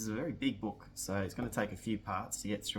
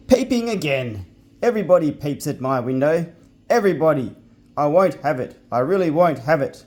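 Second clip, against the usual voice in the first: higher, louder furious pixie rant; angry pixie yelling; 5 semitones higher, 14 dB louder than usual. Acted. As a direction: rage at the girl peeping at his window. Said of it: "yelled loudly".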